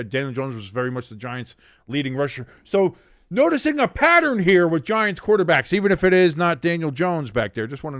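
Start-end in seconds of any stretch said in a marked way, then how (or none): 2.6-7.7 excited. Real, not acted. Excited rant about Giants QBs.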